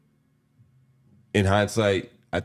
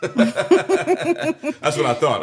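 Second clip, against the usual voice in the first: drawn out high, through a real laugh; 7 dB louder, much slower than usual. Real, not acted. Laughing as the friend answers no. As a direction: let the words stretch out through laughter.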